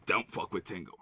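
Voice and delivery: low, hushed and secretive; the friend's one line, hushed; hushed quote. Retold or imitated. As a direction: drop to a near-murmur like passing on a secret. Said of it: "They say".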